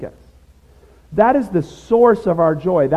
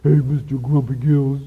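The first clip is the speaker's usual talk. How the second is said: light chipper teasing voice; a hint of a bubbly cartoon fish; cheerful friend; 3 dB louder than usual. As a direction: tease your gloomy friend cheerfully. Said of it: "his always cheerful, chipper friend says".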